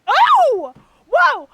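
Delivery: sudden loud high gasp of surprise; surprised shout. Real, not acted. Streamer startled as the opening begins, loud surprised shout. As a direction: caught off guard, blurt it out loud.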